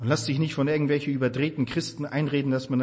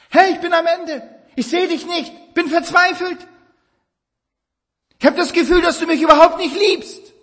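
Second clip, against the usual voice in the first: desperate lament; 14 semitones higher, 7 dB louder than usual. Acted. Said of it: "Sag ihm alles, was dich bewegt".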